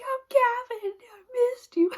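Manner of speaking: mock-gushing wife back from amnesia; hammed-up tearful reunion. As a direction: ham it up like a soap-opera reunion. Pretending to come back.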